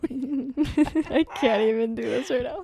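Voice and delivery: slow, words squeezed out through laughing; cracking up mid-story, slow laugh-talk; helpless laughter. Real, not acted. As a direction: laugh so hard you can barely speak. Laughing too hard to talk.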